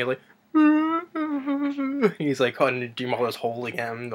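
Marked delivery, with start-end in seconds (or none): 0.5-2.1 high mock whimper; imitated monster crying. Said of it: "like, crying".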